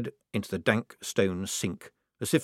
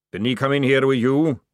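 louder gruff sergeant question; Jackram, loud query; 4 semitones higher, 6 dB louder than usual. Acted. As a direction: bark the question. Jackram asks recruits.

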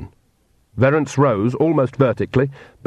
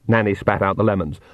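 ordinary delivery, nothing marked

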